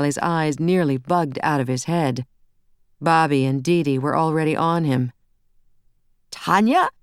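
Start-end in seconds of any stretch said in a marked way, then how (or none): 6.3-6.9 squeaked. A narrator's reading. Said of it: "Ben squeaked".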